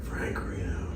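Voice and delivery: whispered name, under the breath; hushed whisper of a name. Real, not acted.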